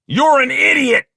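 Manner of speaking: high, loud insulting yell; exploding in anger; angry shout. Acted. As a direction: shout it furiously at your partner. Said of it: "shouted Carl".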